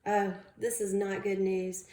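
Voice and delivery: plain reading voice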